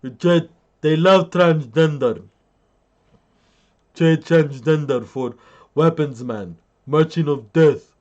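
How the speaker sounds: loud mock Russian accent. Russians boasting about trading for weapons man, in a loud mock Russian accent. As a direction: play a comic Russian, punched out. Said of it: "Beautiful Russian accent".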